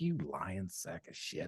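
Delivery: very soft, slow aside; muttered contempt. Retold or imitated. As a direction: mutter it as a private thought. Voicing silent contempt for braggart.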